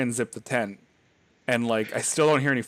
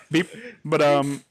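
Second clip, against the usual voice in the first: ordinary talk, nothing marked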